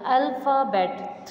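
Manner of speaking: "Alphabet" drawn out into three slow syllables. Shown as a demonstration. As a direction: sound out "Alphabet" slowly. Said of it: "we will pronounce the word".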